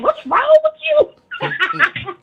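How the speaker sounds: high, slow retold exclamation; laughing disbelief. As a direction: squeal it slowly through a laugh.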